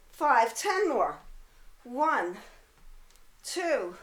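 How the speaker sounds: usual speaking voice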